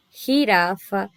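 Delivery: "Girafa" devagar, G raspado na garganta como jota espanhol; J espanhol lento. Shown as a demonstration. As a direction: demonstre o jota em "Girafa" com calma. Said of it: "olha ali que eu falei do J". (jota gutural bem marcado em "Girafa")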